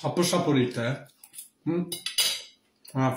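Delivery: talking with food in mouth; muffled by a bite of food; chewing while talking. Real, not acted. Seen 0: Puts food in mouth with fork, then speaks with closed, working jaw.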